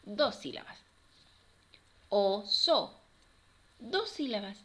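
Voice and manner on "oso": "O-so" in two separate beats; teacher segmenting "Oso" for children; syllable by syllable. Shown as a demonstration. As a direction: split "Oso" with a clear gap. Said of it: "vamos a separar cada una".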